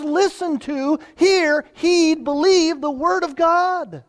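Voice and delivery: high, loud preacher's call; preaching at full force. Acted. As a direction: ring it out from the pulpit.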